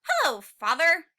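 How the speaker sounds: greeting father. Acted.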